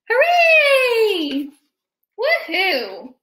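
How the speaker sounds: loud high cheer. Acted. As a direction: celebrate out loud.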